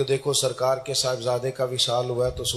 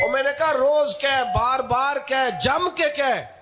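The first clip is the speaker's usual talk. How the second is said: fervent preaching; 8 semitones higher, 3 dB louder than usual. Real, not acted. Preacher glorying in praise of the Prophet, high fervent proclamation. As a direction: proclaim the verse with climbing fervour.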